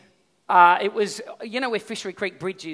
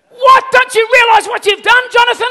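shrill burst, much higher and louder; ramped-up yell; 16 dB louder than usual. Retold or imitated. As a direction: explode at the cheeky kid. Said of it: "I ramped up again".

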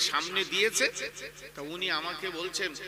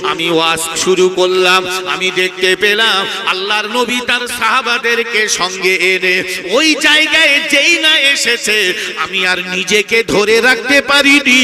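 full-force preaching; 3 semitones higher, 18 dB louder than usual. Acted. Preacher telling listeners of a holy vision, full-force preaching. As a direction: preach it at the top of your voice, stretching the final words.

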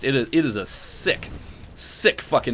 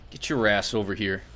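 ordinary talk

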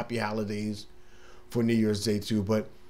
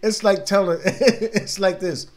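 talking through laughter, slowed; laughing; 6 semitones higher, 4 dB louder than usual. Real, not acted. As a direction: laugh while you speak. Laughing while explaining.

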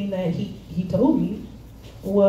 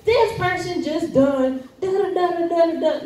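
high, playful gossip voice; casual chatty retelling; 9 semitones higher than usual. Shown as a demonstration. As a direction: rattle it off like telling friends. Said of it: "like how you normally be talking with your friends".

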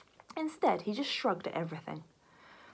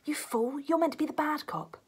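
scolding detective; 8 semitones higher than usual. Acted. Detective scolding his partner.

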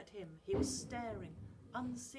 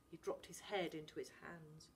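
ordinary delivery

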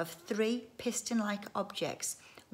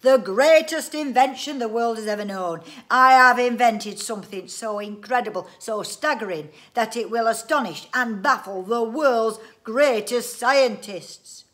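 loud, raised, grandiose boasting; triumphant proclamation; 4 semitones higher, 14 dB louder than usual. A narrator's reading. Inventor announces his invention to the family, loudly triumphant. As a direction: proclaim it to the whole world. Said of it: "cried it triumphantly, waving his arms".

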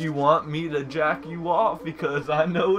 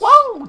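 loud, much higher falling whoa; cartoon jump whoa; 8 dB louder than usual. Shown as a demonstration. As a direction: whoop like Crash jumping. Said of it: "That's how he is".

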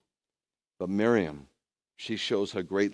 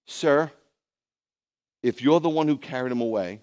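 louder, raised pleading voice; distraught woman, lightly acted; 4 semitones higher, 6 dB louder than usual. Miriam appeals to the supposed gardener, mildly frantic. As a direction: address him urgently, a touch distraught. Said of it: "she's going crazy".

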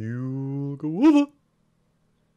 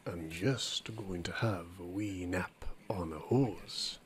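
a low unvoiced whisper; 10 semitones lower, 14 dB quieter than usual. The dog settles for a nap on the hose in a whisper. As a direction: breathe it out as you doze off.

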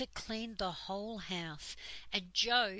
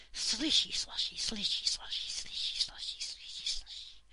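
whispered splashy sound words, dying away; sloshing water sounds. A narrator's reading. Washing tub emptied over the children, whispered sloshing.

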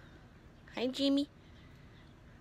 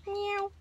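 cat meow; 12 semitones higher than usual. Acted. Meowing at the cat.

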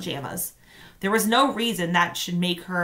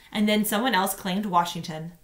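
ordinary delivery, nothing marked

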